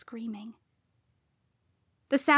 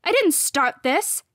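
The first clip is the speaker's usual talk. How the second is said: higher, louder, defensive; heated raised voice; angry protest; 6 semitones higher, 6 dB louder than usual. A narrator's reading. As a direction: hurl back the accusation. Heated quarrel between rivals.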